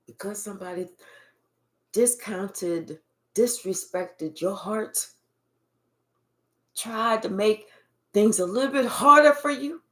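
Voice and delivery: low, hushed reading of the spirit's message; channelled message. Acted. Reader relaying the spirit's message to the listener, low and hushed. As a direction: ask the spirit quietly, then relay it.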